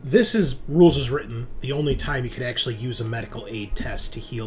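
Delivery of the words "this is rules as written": ordinary talk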